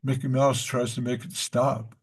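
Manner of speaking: ordinary talk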